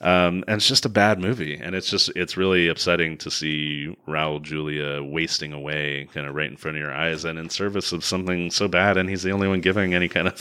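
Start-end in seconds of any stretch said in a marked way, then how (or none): none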